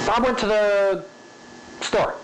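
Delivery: rushed start, pause before "store"; varied spacing. Shown as a demonstration. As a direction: rush up to "the", wait, drop in "store". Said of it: "where you linger, and where you rush".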